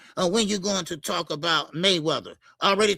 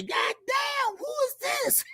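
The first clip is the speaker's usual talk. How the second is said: groggy morning-after wake-up; 4 dB quieter than usual. Retold or imitated. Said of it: "You didn't wake up and say".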